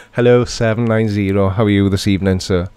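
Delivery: ordinary delivery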